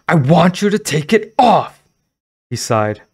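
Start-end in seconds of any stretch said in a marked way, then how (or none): 0.1-1.8 high, loud insisting shout. Acted. Girl shouting at the silent store owner, insistent.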